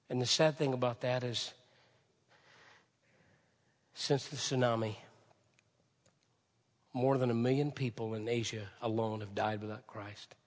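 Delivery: ordinary talk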